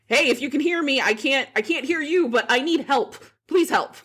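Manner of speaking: high, urgent plea into a phone; mock cry for help down the phone; retold yell for help. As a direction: call out for help, pitched well up. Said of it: "kinda yell into the phone".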